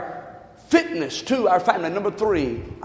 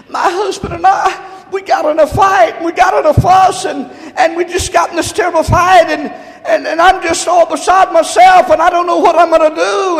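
distraught wife; 6 semitones higher, 6 dB louder than usual. Retold or imitated. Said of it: "She was crying, boo-hooing, sobbing".

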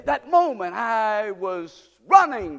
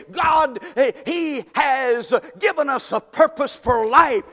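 loud, higher preaching shout; full-force preaching; 6 semitones higher, 7 dB louder than usual. Acted. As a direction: drive it home to the congregation. Preaching climax to congregation.

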